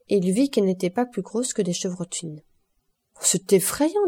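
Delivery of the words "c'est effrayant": frightened gasp of awe, pitched high; stunned after seeing the count shoot. A narrator's reading. Morel, shaken by the count's aim, frightened exclamation.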